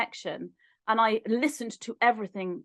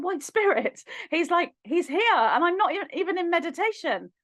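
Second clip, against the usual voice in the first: excited retelling; 7 semitones higher than usual. Retold or imitated. Telling brother spirit is here.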